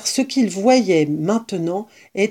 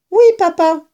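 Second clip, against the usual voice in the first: put-on much higher, child cub voices; eager cubs; 10 semitones higher, 4 dB louder than usual. A narrator's reading. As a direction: answer father brightly as little cubs. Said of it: "répondirent-ils".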